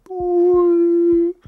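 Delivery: sudden, very high and loud "Boo" like a flashbang going off; flashbang imitation. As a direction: blast it out like a stun grenade exploding in a video game. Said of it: "when a flashbang goes off ... it goes like".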